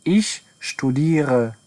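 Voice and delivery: a drawn-out teaching pace; very slow model phrase. Shown as a demonstration. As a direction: stretch out every syllable of studiere.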